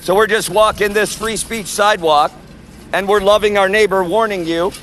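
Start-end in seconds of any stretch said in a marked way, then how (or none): 2.9-4.7 street sermon. Real, not acted. Street preacher warning the crowd outside the event, preaching. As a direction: call the passers-by to repent.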